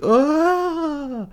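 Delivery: very high, held mock scream; long, hammed-up ghost shriek; imitated scream. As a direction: wail like a fleeing phantom. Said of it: "just, like, screams".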